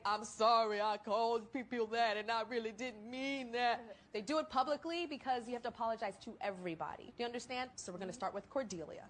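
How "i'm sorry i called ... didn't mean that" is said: mock public apology. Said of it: "people go on the air when they make a mistake".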